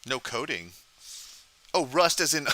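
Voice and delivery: normal talking voice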